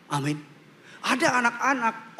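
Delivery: ordinary talk, nothing marked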